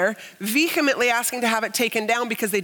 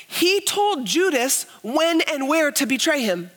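full-force preaching; 5 semitones higher than usual. Acted. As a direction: proclaim it to the back row. Preaching on Jesus' choice.

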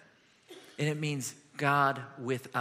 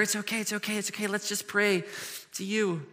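put-on softer, tender hush to a hurt child; soothing father; 4 semitones higher, 4 dB quieter than usual. Joseph comforting little Jesus after a fall, soft and tender. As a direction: comfort him gently.

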